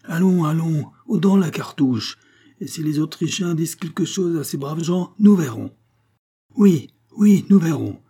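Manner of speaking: high, loud rallying cries; soldiers' shout. A narrator's reading. Soldiers loading cartridges against the Austrians, high defiant shouting. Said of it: "crièrent les soldats".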